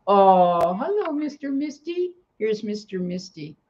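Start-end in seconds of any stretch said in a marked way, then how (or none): 0.1-2.1 high sing-song greeting to the cat; pet talk. Real, not acted. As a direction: coo hello up high to the cat.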